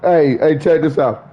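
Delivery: ordinary talk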